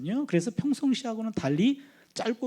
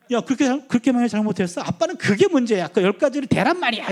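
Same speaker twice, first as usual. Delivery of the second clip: higher, louder indignant scolding; child scolding; 4 semitones higher, 6 dB louder than usual. Retold or imitated. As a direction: tell him off like a fed-up kid. Daughter scolding dad through door.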